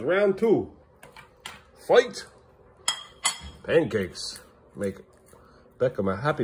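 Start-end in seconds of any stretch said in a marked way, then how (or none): 0.0-2.3 mock fight announcer. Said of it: "game announcer imitation".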